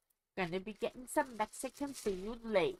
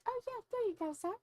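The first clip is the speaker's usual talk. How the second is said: high casual command voice; mock commander; 13 semitones higher, faster than usual. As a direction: toss it off like ordering a servant. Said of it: "I should be able to just say".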